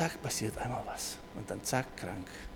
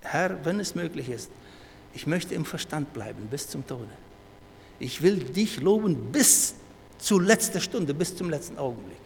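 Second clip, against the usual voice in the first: fervent prayer; 2 semitones higher, 6 dB louder than usual. Retold or imitated. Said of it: "das Gebet". (inbrünstiges Gebet)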